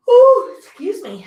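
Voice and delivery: said through a loud, high yawn. Real, not acted. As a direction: let the yawn stretch the words out slowly. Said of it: "I don't know why I'm yawning".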